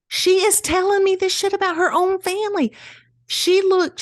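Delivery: high, loud disbelief; incredulous exclamation. Real, not acted. Host telling co-host about the nosy guest, incredulous. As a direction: sound scandalised at the overshare. Outraged retelling of the lady.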